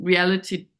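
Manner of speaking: ordinary delivery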